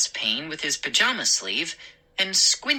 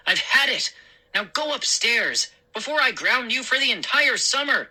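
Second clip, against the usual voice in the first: high, fed-up scolding; snapped; 8 semitones higher, faster than usual. Acted. Father threatening to ground son, snapping. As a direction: lay down the law crossly. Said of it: "he snapped".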